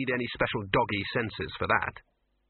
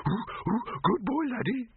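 cooing voice; 12 semitones higher, slower than usual. A narrator's reading. Cooing at dog.

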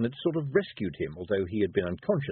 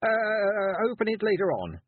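put-on higher, louder flustered boy; stammering nervous boy; 12 semitones higher, 3 dB louder, slower than usual. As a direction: blush and stutter through it. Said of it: "blush and stutter".

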